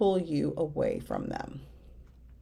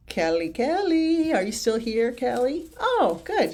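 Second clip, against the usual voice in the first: calling out sing-song; 6 semitones higher than usual. Real, not acted. Calling out to a viewer in chat.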